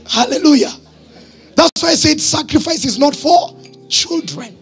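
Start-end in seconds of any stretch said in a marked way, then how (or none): none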